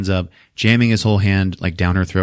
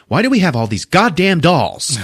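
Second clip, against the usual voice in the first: exasperated outburst; 8 semitones higher, 3 dB louder than usual. Retold or imitated. Doctor exasperated by mailed doll.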